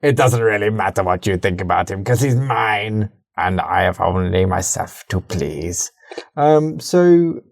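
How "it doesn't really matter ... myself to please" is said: Imperator voice. Acted. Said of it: "the Imperator voice".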